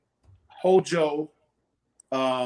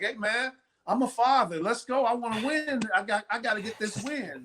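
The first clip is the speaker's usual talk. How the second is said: excited new father; 6 semitones higher than usual. Retold or imitated. New father pacing the dugout.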